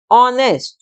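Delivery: "ऑनेस्ट" said starting on an o sound, no h; silent h. Shown as a demonstration. Teacher demonstrates "ऑनेस्ट" for her students. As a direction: model "ऑनेस्ट" with the h dropped. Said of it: "ऐसे हम बोलते हैं इसको ऑनेस्ट".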